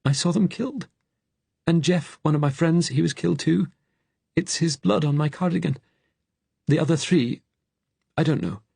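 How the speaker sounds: high, distressed fugitive; strained high voice of grief; Frank. A narrator's reading. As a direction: recount the killings in a high, upset voice. Frank recounts friends' deaths.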